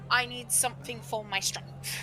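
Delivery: blank deadpan. Acted. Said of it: "with a blank look on her face".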